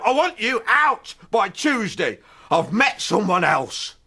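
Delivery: high, slow mock breakup line; hammed-up lover's dumping; cold breakup. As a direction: announce it slowly, high and firm. Dumping his anger.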